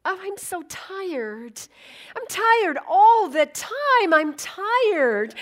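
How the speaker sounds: high, loud, drawn-out complaint of being tired; mock exhausted lament; weary waitress. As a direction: whine that you are always exhausted. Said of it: "And she went".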